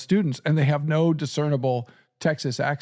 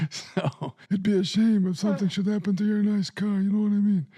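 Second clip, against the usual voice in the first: a lightly voiced mafia heavy, menacing in jest; mock mobster threat; 6 semitones higher than usual. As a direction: make a playful gangster threat, higher. Veiled mobster threat joke.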